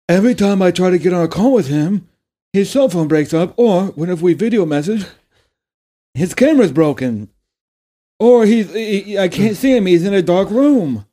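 high, loud, whiny complaining voice; whiny dating show guest. Retold or imitated. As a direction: whine it like a duped girlfriend. Mimicking a catfished guest.

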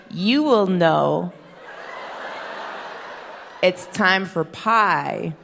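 slow, deliberate sage pronouncement; a mom answering her child with mock wisdom, slow and grave; slow solemn mom wisdom. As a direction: lay it down slowly like a proverb.

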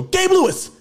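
high, loud starstruck scream of the name. Retold or imitated. Fan recognizing an actor at the door, screaming his name. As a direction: shriek the name right at him. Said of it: "I screamed in his face".

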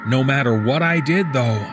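plain reading voice

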